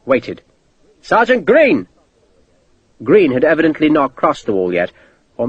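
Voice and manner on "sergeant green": calling out. A narrator's reading. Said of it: "Sharp called".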